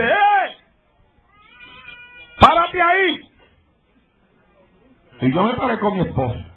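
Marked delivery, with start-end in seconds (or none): 0.0-3.2 put-on high drunk man's shout stopping them; drunk man yelling. Drunk man calls out to the pastor from the bar, high shouted. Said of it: "me dice".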